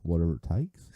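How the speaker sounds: badly faked Australian accent; a weak stab at Australian. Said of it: "The Australian guy from Suicide Squad?".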